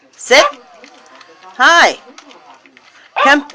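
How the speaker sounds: normal talking voice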